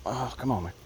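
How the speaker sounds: ordinary delivery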